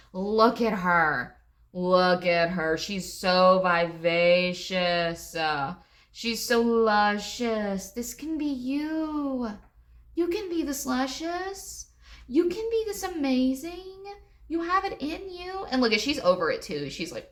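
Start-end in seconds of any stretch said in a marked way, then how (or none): 0.1-7.9 slow, loud, stretched admiration; savoring each word in awe; drawn-out gushing. Real, not acted. Gushing over the card's figure. 7.9-15.7 gushing encouragement. Real, not acted. Hyping the listener up.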